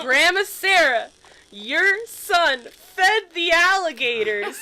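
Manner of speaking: loud, high, slow announcement; tattling child. Retold or imitated. Five-year-old tells grandma on the phone, tattling loudly. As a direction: drag out each word, savoring the tattle. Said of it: "took the phone while staring at my dad".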